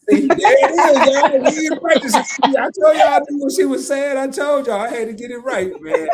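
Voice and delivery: high, loud and laughing; loud delighted laughter; excited laughing cheer. Real, not acted. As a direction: celebrate getting it right, laughing. Cheering, got the phrase right.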